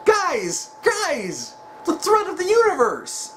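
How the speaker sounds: high, pleading mock exasperation; exasperated deity. Ignored guardian begging the fighters, high exasperated plea. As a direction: squeak it up high like a god nobody listens to. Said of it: "Supreme Kai is just sitting there like".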